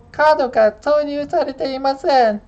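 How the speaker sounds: high, polite Japanese machine announcer voice in Japanese; Japanese car voice. Retold or imitated. Imitating the toll box's Japanese lady for viewers, high polite announcement. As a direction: mimic the dashboard lady's chirpy Japanese warning. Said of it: "the Japanese lady that's speaking to them".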